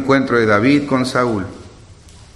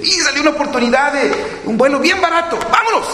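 much higher, eager and rushing; mock excitement; 14 semitones higher, faster than usual. Imitating a believer who spots a cheap flight, hammed-up excitement. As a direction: jump at the cheap flight like a thrill-seeker. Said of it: "A veces uno dice".

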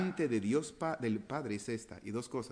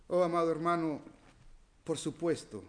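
ordinary delivery

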